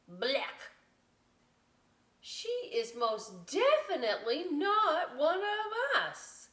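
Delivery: high, loud, slow outraged protest; indignant nerdy birds. Acted. Nerdy birds rejecting the vulture, high and loud. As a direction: stop them cold, scandalized.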